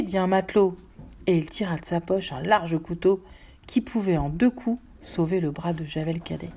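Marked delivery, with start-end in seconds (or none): none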